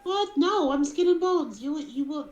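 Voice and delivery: high, loud panicked protest; panicked bunny. Acted. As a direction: squeal it out like a rabbit about to be eaten. Bunny panics at being eaten.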